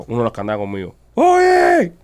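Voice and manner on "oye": loud, high-pitched imitated yell; retold shout, pitched way up and drawn out; shouting across a store. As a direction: holler it to a friend far down the aisle. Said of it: "alguien grita por allá".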